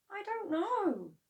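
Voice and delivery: put-on high, slow evasive boy; boy's voice. A narrator's reading. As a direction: dodge the question. George evasive.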